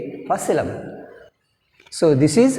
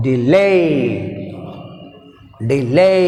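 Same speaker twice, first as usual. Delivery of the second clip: "Delay" with a strong, drawn-out "lay"; short "De", then lift "lay" in "Delay"; stress on second syllable; much slower than usual. Shown as a demonstration. Teacher modelling "Delay", second syllable stressed. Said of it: "See here, I am pronouncing".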